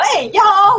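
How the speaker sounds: loud, high hammed-up Southern holler. Shown as a demonstration. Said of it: "I'm not trying to do the stereotypical".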